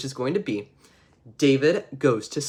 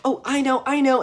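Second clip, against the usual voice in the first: put-on much higher, eager girl voice; eager little girl; 10 semitones higher than usual. Sally eager to answer the teacher, high and bright. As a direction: bounce with the answer. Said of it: "little Sally is like".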